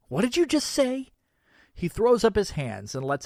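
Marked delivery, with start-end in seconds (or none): 0.1-1.1 angry. Acted. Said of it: "rage boiling up".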